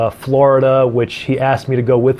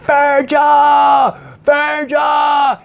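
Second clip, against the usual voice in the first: high angry yelling of a name; shouting; 13 semitones higher, much slower than usual. Retold or imitated. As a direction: yell the name from far off. Boss yelling from garage.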